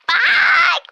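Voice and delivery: high squeaky shouted bye; a screeching shout of "Bye!"; dying goat bye. Real, not acted. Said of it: "I just sounded like a dying goat".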